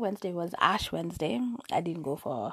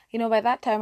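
ordinary talk, nothing marked

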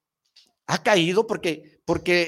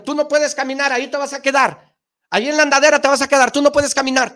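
loud, high mocking discouragement; mocking shout; 8 semitones higher, 8 dB louder, faster than usual. Imitating people telling a toddler he can't walk, loud and high. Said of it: "O nos dijeron".

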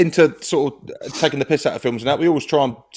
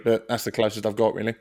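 normal talking voice

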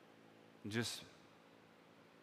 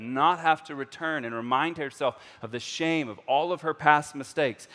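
loud, high, driving sermon; fervent preaching; 6 semitones higher, 10 dB louder than usual. Acted. Pastor driving home the woman's shame, loud fervent preaching. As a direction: preach it with urgency.

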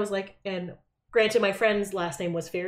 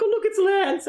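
mock delight; 12 semitones higher than usual. Girls joking at a friend's house, high teasing exclamation. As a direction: point him out with gleeful mockery. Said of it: "we're like".

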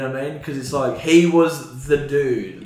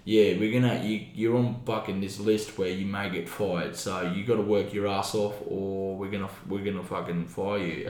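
quiet boss warning; 6 semitones lower, 11 dB quieter than usual. Retold or imitated. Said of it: "they're like".

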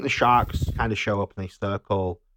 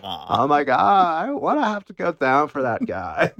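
mock dread; 6 semitones higher than usual. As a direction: drag it out like a reluctant diver. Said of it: "they're both like".

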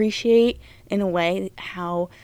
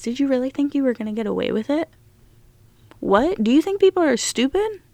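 incredulous; 7 semitones higher, faster than usual. Real, not acted. Disbelief at killer's arrogance.

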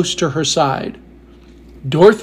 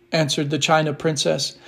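ordinary delivery, nothing marked

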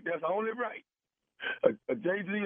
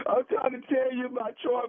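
plea pitched higher, like fake tears; mock crying; 7 semitones higher than usual. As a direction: fake a teary break on the whole line. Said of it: "Are you, are you crying, man?".

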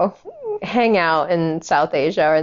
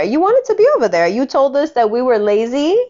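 high, fast, louder scolding; raised, rapid, furious; angry rant; 5 semitones higher, 4 dB louder than usual. Real, not acted. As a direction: hurl it at her. Ranting at the mother.

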